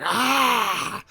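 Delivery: a high, loud cry of rage; frustrated yell. Retold or imitated. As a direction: let out a sudden furious "Ah!". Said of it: "I'll be like".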